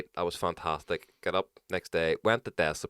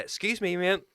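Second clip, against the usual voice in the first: polite wake-up call; 10 semitones higher than usual. Retold or imitated. Politely waking stranger, retold.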